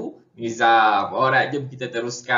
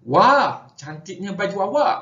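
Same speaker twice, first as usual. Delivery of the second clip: higher-pitched "wah" of admiration, demo; admiring exclamation; 6 semitones higher, 3 dB louder than usual. Shown as a demonstration. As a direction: exclaim a compliment with a raised voice. Teacher acts admiring exclamation example. (seruan kagum dengan nada meninggi)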